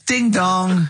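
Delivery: slow, high sing-out doorbell chime; doorbell imitation. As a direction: ring the bell with your voice, drawn out. Imitates a doorbell for character's entrance.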